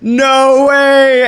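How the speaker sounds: high, loud, laughing disbelief; stunned disbelief. Retold or imitated. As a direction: gasp it out, laughing in shock. Meeting the man years later.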